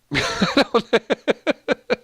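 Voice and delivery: long, high burst of laughter; laughing. Real, not acted. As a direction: crack up helplessly at a comment. Cracks up at a chat comment.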